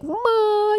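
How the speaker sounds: very high cooing aww; aww sound. Retold or imitated. Crowd cooing at the toddler, very high aww. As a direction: coo like a charmed crowd. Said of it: "everybody's like".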